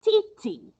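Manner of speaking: two short spoken beats; rhythm demo. Shown as a demonstration. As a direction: tap out "T T." crisply. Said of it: "it sounds like this".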